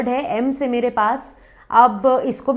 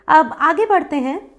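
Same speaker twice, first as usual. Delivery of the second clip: usual speaking voice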